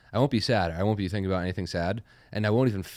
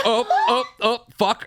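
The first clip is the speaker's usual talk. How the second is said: mock startled gasp, high with a laugh; shocked realization; 14 semitones higher, 5 dB louder, slower than usual. Retelling an argument where tears came, mock startled. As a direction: play the sudden shock of finding yourself crying. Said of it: "And I was like".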